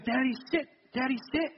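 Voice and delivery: a hint of a toddler tugging at dad; dad mimicking his little son's demand, lightly voiced; imitating toddler son. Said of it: "but he goes".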